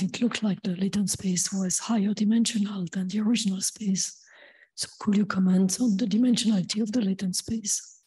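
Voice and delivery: real hoarse voice, partly whispered; lost voice. Real, not acted. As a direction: struggle to speak clearly through a lost voice. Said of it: "I've lost my voice".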